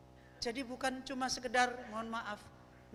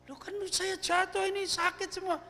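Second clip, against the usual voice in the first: higher, aggrieved whining about the fall; complaining passenger; 10 semitones higher, 4 dB louder than usual. Retold or imitated. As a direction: passenger moaning that everything hurts. Passenger complains after falling. (penumpang mengeluh kesakitan, suara meninggi)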